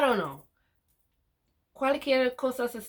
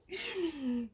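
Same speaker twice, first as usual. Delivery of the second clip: embarrassed laugh; 8 semitones higher, 7 dB quieter than usual. Real, not acted. Laughs at own stumbling.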